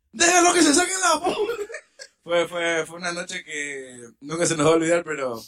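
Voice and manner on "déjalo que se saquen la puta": high laughing call; high laughing retold shout; laughing mock call. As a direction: laugh through it.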